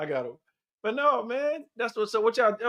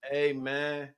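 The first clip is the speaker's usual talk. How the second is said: Taye Diggs impression; 6 semitones lower, 6 dB quieter than usual. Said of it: "my Tay Diggs voice".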